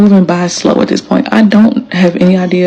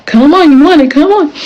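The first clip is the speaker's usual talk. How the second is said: coaxing a stubborn cat out the door; 7 semitones higher than usual. Retold or imitated. Wife coaxing drunk husband outside, high sweet coaxing. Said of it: "coaxed him outside like a cat with some catnip".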